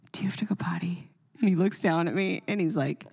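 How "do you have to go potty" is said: soft whispered question. Retold or imitated. Mom whispering up to her son, hushed. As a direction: ask it in a hush.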